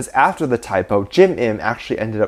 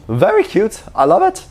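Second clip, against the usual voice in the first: gushing squeal; 9 semitones higher than usual. Real, not acted. Fan gushing to viewers over [name]'s letter, high and squeaky. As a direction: melt over it in a tiny high voice.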